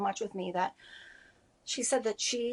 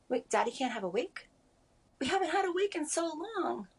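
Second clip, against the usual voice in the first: lightly voiced child's protest, rising much higher on the second sentence; six-year-old daughter; 7 semitones higher than usual. Mother retelling her six-year-old's question about Daddy's wake, childlike protest. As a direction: imitate a puzzled little girl, pitch climbing. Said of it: "My six-year-old also said".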